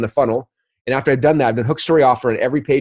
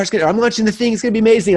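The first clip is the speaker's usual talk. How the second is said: higher, eager gush; mock hype; 9 semitones higher, faster than usual. Members texting before a launch, mock-excited gushing.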